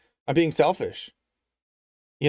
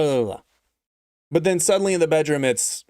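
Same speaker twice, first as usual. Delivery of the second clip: ordinary delivery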